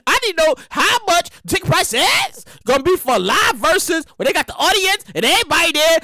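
ordinary delivery

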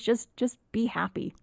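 normal talking voice

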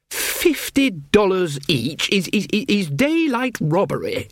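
high, slow, stammering indignation; spluttering outrage. Acted. Passenger protesting the coach fare, outraged.

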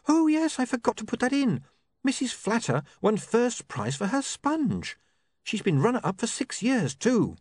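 put-on earnest girl passing on news; young woman Sacharissa. A narrator's reading.

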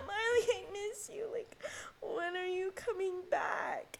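high, drawn-out whiny imitation; singsong, exaggerated "miss you" whine; mock pining friends. Mimics classmates missing her.

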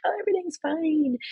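fake cheerful. Faking fine at church.

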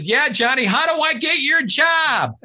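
high loud call from the back row; high shouted question from the crowd. Retold or imitated. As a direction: yell it up to the stage.

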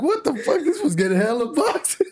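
high-pitched mock-baffled exclamation; retold disbelief. Friend retelling the boxes piling up, high-pitched disbelief.

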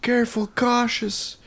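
high, slow tearful woman; a squeaky, weepy confession, slow. Retold or imitated. Suzanne voiced in a story.

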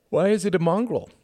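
put-on high, curious boy's question; a small boy piping up; young boy. A narrator's reading. Young Tom asks a question.

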